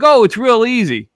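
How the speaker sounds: high, loud imitation of a confident friend; cocky boast. Friend telling doubters it's easy, mock cocky.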